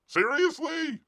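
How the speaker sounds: a high, exasperated Seriously?; disbelieving high Seriously?; incredulous squeak. Real, not acted. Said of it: "rising repeated seriously".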